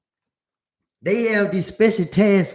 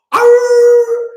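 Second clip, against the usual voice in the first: loud, high growling snarl; dog growl; 12 dB louder than usual. Retold or imitated. Dog snarling at the police, loud growl. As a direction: growl like an angry guard dog. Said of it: "He said".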